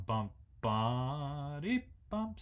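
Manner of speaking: bump, BA-dee, the second beat held long. Shown as a demonstration. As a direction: sing it like a Baroque dance. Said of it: "emphasizing the second beat by playing the second beat long".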